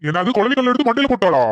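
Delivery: high-pitched, startled question; shocked disbelief. Acted. Husband hears his wife's friend hit her husband, shocked. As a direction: echo it back in alarmed disbelief.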